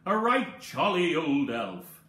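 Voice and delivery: ordinary delivery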